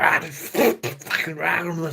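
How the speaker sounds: high, slow, spitting mock German rant; mock Hitler rant. Mocking how Hitler sounds ranting in German to the pub. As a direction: ham up a furious dictator speech. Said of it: "spitting everywhere and all that".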